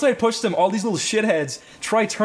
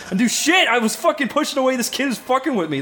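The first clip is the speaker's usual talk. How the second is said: raised-pitch, mildly heated self-defence; mock indignant protest; 7 semitones higher than usual. Teller defending himself to his friend, mildly indignant.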